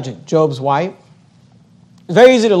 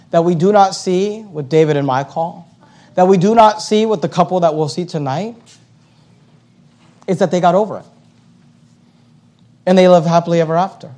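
ordinary talk, nothing marked

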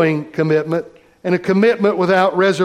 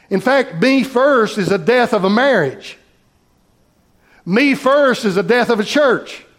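preaching; 3 semitones higher than usual. Acted. Preacher builds refrain.

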